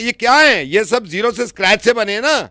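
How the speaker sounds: ordinary talk, nothing marked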